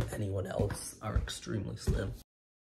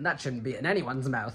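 ordinary delivery

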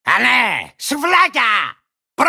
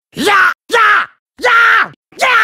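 short high yells, last one drawn out; building attack cries; 5 semitones higher, 4 dB louder, much slower than usual. Acted. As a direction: yell with each strike, growing wilder. (κοφτές πολεμικές κραυγές που δυναμώνουν)

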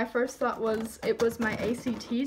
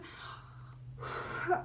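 teary sigh; 5 semitones higher, 9 dB quieter than usual. Real, not acted. Overwhelmed opening favorite band record.

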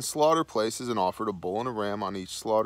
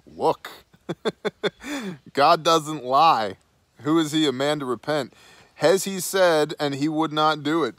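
higher, emphatic retelling of an exasperated prophet; louder, lightly hammed-up exasperation; mock exasperation; 5 semitones higher, 6 dB louder than usual. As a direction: act out fed-up insistence, with a laugh after the first word. Retells Balaam lecturing the king.

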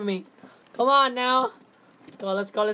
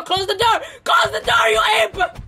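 high, loud shout at a teammate; panicked shout; 11 semitones higher, 8 dB louder than usual. Real, not acted. Player screaming at teammate to close the door, panicked shout. Yelling at teammate during chase.

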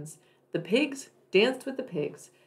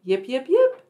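high, loud yipping fox call; 5 semitones higher, 7 dB louder than usual. Acted. Little fox at the barn calling again, loud and high. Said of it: "called again".

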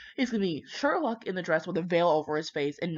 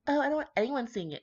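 mocking the bride; 7 semitones higher, faster than usual. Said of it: "she's like".

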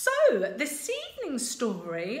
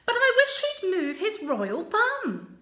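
high, loud, impatient princess voice; a fed-up princess moaning from her tower; cheeky princess, higher and louder; bored princess; 9 semitones higher, 6 dB louder than usual. Acted. Princess Sue complaining, bored.

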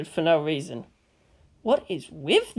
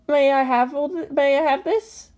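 put-on higher, hopeful boy asking; 8 semitones higher than usual. A narrator's reading. Shinji asking for chainsaw.

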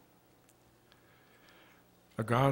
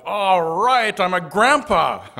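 loud, high whoop of joy; full-volume, high-pitched celebration; jubilant yell; 10 semitones higher, 15 dB louder, slower than usual. A narrator's reading. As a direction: yell it out like a thrilled new grandpa. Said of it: "and he yelled".

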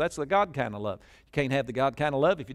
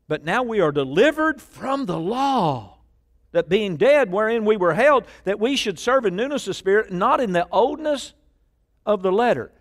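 preaching at full force; 8 semitones higher, 7 dB louder than usual. Acted. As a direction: proclaim the verse to the whole church. Reads scripture at full force.

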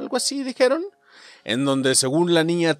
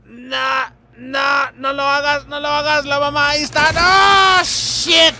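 high, loud panicked no's; panicked shout; 11 semitones higher, 4 dB louder, slower than usual. Real, not acted. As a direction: beg in sheer panic. Teme que la mamá lo atrape. (grito de pánico, agudo y fuerte)

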